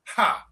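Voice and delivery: a single loud Ha; laugh syllable ha. Shown as a demonstration. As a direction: bark out one Ha. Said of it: "Can you make this noise with me".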